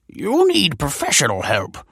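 high-voiced scholarly rebuff; flustered, haughty put-on voice; indignant scholar. A narrator's reading. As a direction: dismiss him in affronted alarm. Scholar rebuffs the pleading boy.

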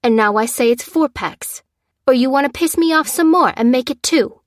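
belligerent, escalating threat; threatening. Acted. Seller threatens a cheated customer.

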